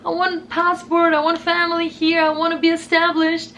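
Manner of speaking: high, fast desperate plea to God; high pleading whisper-prayer. Retold or imitated. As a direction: beg God with everything you've got. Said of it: "I was already praying".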